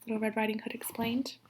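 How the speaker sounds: plain reading voice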